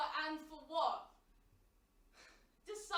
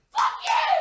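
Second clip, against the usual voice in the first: raw, shrieking shout, much higher and louder; furious scream at the photographer; 20 dB louder than usual. Acted. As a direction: scream it at the camera with everything. Screaming at the camera.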